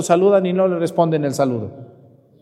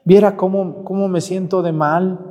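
a hint of a grieving elderly woman, higher; lightly voiced old lady; 4 semitones higher than usual. Elderly lady telling the priest how bad she feels, lightly voiced. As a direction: sigh it like an old woman missing her helper. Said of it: "ella me decía".

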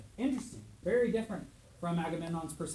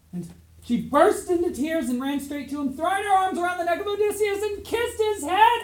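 much higher and louder, gushing; thrilled storyteller at the lovers' reunion; excited reunion; 11 semitones higher, 8 dB louder than usual. Acted. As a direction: sweep the class up in the joy.

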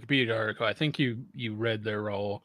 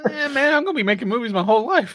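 carefree; 8 semitones higher, 6 dB louder, faster than usual. Retold or imitated. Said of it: "And, like".

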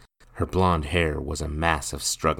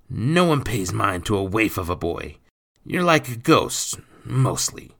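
raised, loud rough persuasion; scheming stepfather; 5 semitones higher, 6 dB louder than usual. A narrator's reading. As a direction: talk the boy into the scheme. Stepfather explains his scheme.

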